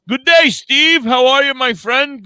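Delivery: a hint of an Australian accent, pitched high and loud. Australian host greets a viewer in a mock Australian accent. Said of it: "our friend from Australia, you know, 'cause he says".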